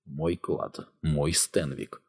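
very soft, slow thought to himself; his own private claim, said softly; inner thought. A narrator's reading. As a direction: murmur it inward, as if unspoken. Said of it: "подумал".

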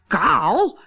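short, high, loud shocked question; yelp. A narrator's reading. Captain shocked by the bride's baldness, yelping. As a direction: yelp it in disbelief. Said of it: "gilde de kapitein".

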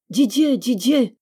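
crying out a name. Acted. Girl under the window calls to Didier, slow and loud. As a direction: call his name out into the night. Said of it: "cria dans la cour".

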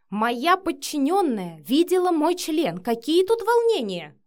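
loud, high, slow outrage; indignant retort. A narrator's reading. Matvey complains his subordinate saw him, indignantly. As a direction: retort with exasperated emphasis. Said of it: "парировал Матвей".

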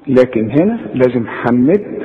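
ordinary talk, nothing marked

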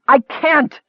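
high, fierce outburst; savage cry. A narrator's reading. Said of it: "Michael cried savagely".

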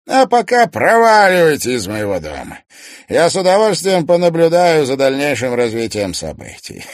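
high, loud gloating villain; theatrical villain. Acted. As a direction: relish every cruel word. Toymaster gloats.

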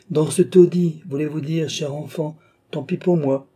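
lightly voiced high-pitched princess; gracious self-reproach. A narrator's reading. Princess deploring her protégée's poor lodging, animated self-reproach.

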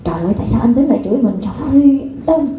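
hammed-up aggrieved outburst; the wrong way, ranting at someone who scolded her; indignant complaint. Shown as a demonstration. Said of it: "Chứ Liên không nói là".

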